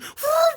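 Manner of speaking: high whistle-like whoop. Retold or imitated. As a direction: yelp out a whistle sound. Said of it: "gonna be like".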